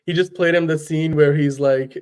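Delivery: normal talking voice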